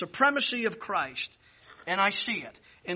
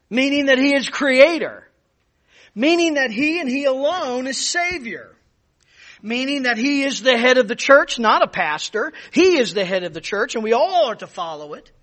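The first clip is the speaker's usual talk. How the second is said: louder, higher fervent preaching; preaching at full force; 5 semitones higher, 8 dB louder than usual. Acted. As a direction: build it like a pulpit climax. Preaching climax, rising litany.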